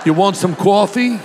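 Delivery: high-pitched mock East Coast wise guy; hammed-up East Coast accent. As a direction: play it as a brash East Coast caricature. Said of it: "Or East Coast".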